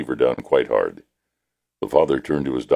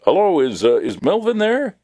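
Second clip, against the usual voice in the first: innocent caller; 14 semitones higher than usual. Acted. Father prank-calls a stranger.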